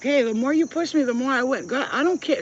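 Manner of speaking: loud, high mocking taunt; taunting shout. To the pushy lady at the table, loud high taunt. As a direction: rub the winning streak in her face. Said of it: "I was like".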